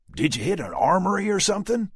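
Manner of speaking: high, loud Texan question. A narrator's reading. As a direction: ask it like a curious cowboy. Dallas voiced, Southern stranger.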